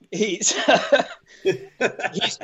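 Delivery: breaking into giggles mid-word; can't get the word out for laughing. Real, not acted. Laughing at his own slipped accent while quoting a client.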